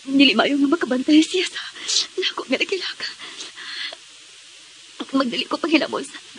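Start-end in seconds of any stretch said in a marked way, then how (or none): 1.4-3.1 soft unvoiced whisper; hushed whisper so no one hears. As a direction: breathe it out without voice.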